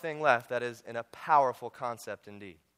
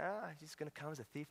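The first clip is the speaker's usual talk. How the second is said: quiet mock aside; 18 dB quieter, faster than usual. Shown as a demonstration. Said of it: "mocking a quiet thief coming".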